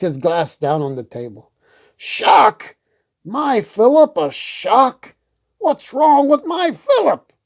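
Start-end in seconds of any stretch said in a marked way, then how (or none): 2.0-7.3 high indignant outrage; offended king. Acted. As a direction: bristle at the insult, pitch way up. Hubert offended by remark.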